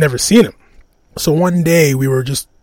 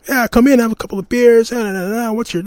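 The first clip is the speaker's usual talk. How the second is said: cheerful invitation; 9 semitones higher than usual. Retold or imitated. Scary neighbour inviting them in for beers, high and eager. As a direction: sell how friendly the tough guy turned out to be.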